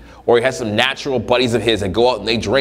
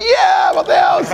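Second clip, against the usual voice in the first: very high, loud whoop of freedom; mock ecstatic shout; 4 dB louder than usual. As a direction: yell it like a freed man. Guy shouting his freedom.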